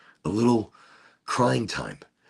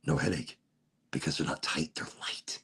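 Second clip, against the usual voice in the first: whisper; 6 semitones lower, 9 dB quieter than usual. Real, not acted. Rhyming about light sunglasses.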